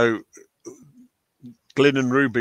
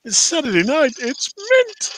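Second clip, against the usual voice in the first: excited; 4 dB louder than usual. Pretend. Repeating own line, hammed up.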